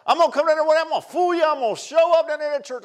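loud, high mock voice of a procrastinator; loud imitation of a man telling the preacher he'll come someday; mocking promise. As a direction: ham up the boastful excuse.

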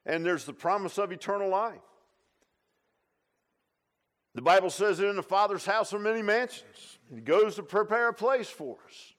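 preaching at full force. Acted. Preacher proclaiming heaven to the church, fervent preaching. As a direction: lift it up and proclaim the promise.

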